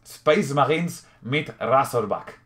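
slow German accent with a front rolled R in "Razorback"; German accent again, now saying "Razorback" with a clean trilled R; deliberate German accent, "Razorback" as "Rasorbak"; German accent, corrected. Shown as a demonstration. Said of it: "bien, con acento alemán".

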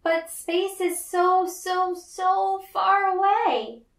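put-on high, slow child voice stretching every so; a small girl gazing up, sighing at the distance; dreamy little girl. Little May tells her dad, high and drawn out. May doubts space is reachable.

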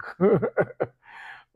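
loud high burst of laughter; a real loud laugh at the joke; big laugh. Real, not acted. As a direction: laugh out loud at your own point.

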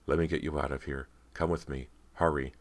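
usual speaking voice

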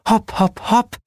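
sweet, lilting urging; velvety goddess voice. A narrator's reading. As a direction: cheer them on in a high velvety voice. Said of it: "mit samtweicher Stimme".

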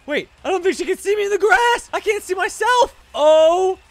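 high, loud gleeful yelling; thrilled loud cheer; excited shout. Real, not acted. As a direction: crow over the clever hiding spot. Hiding in grass from cop.

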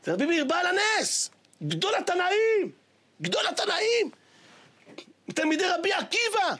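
high exclamations rising to a shout; fervent exclaiming. Real, not acted. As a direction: exalt the great sage. Preacher extols the rabbi.

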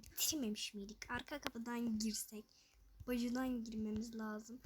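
soft whispered planning. Real, not acted. Gamer planning the back door entrance, whisper. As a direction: plot the route in a whisper.

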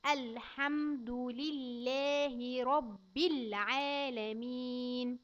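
put-on every syllable drawn out for a child; very slow recitation. Shown as a demonstration. Teacher reciting very slowly for a child. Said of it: "ننطقها كلها على بعضها".

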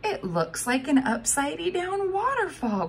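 put-on high, wondering child voice; awed girl. A narrator's reading. Girl watching the geyser, high and awed. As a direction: marvel at the sight.

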